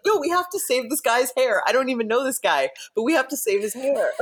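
high, amused mock pleading; retold urgent protest. Hairdresser pleads with crew to save actor's hair, mock-urgent. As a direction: imitate a frantic rescue, breaking into laughter.

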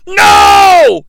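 high, loud shouted no; a mock Jim Ross yell; imitated yell. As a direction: cry out in mock horror. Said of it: "yells J R".

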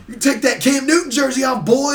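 imitated taunting yell; retold heckle. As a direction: call it out like a rowdy rival fan. Retold hostile fans heckling him.

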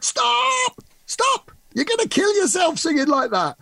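high screech of alarm; teacher halting a bad high note, screeched. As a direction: screech to stop the singer, pitched far up. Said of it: "she screeched".

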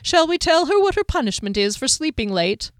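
playful threat of punishment; teasing character. A narrator's reading.

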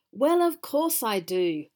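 loud, slow troll bellow; troll shouting at the goat from under the bridge; indignant shout. A narrator's reading. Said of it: "There's no need to shout".

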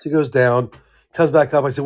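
ordinary talk, nothing marked

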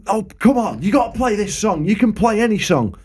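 high, wheedling mock plea; imitating pleading punter. Drunk punter badgering the singer for a song, high mock pleading. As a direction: plead like a pestering drunk, pitched well up.

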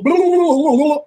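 high, loud stream of mock babble; imitating mad gibberish. Exchange student ranting at her manager, high mock gibberish. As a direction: babble loudly like an angry, incomprehensible girl. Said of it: "Afi's mad about something".

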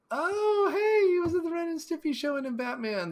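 high-pitched burst of excitement; excited discovery. Real, not acted. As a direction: squeal it in sudden delight, then come down. Sudden excited discovery of a credit.

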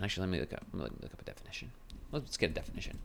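soft, half-whispered to herself; under-the-breath aside while searching; muttered aside. Real, not acted. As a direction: mumble it while looking something up.

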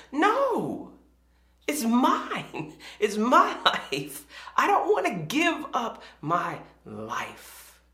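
high, childish mock refusal; petulant like Audrey. Believers refusing God like a child, petulant. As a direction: dig in like a possessive kid, slowing at the end. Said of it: "just like Audrey, we were like".

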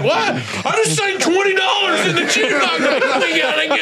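high, indignant yell; outraged brother who just paid the jukebox; indignant outburst. Retold or imitated. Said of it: "He's like".

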